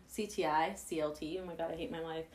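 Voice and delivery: normal talking voice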